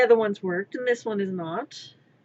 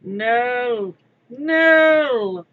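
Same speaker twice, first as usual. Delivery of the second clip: louder, rising cartoon protest; quoted cartoon panic; 9 dB louder than usual. Quoting DBZ Abridged, a panicked loud protest. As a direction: shout it like a panicked cartoon henchman. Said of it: "quoting D B Z abridged".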